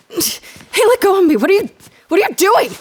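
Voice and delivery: alarmed protest. Acted. Grabbed by agents, shouting at them.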